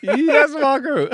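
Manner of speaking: high, loud, slow cheer; drawn-out yes. Real, not acted. Reacting to the joke to his co-host, a slow loud cheer. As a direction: stretch the yes out in delight.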